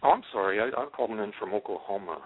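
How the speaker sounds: ordinary talk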